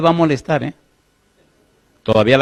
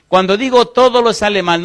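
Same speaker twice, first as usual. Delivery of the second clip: normal talking voice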